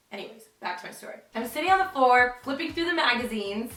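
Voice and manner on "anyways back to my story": fast, whispered aside; quick whispered return to the story; hushed aside. Real, not acted.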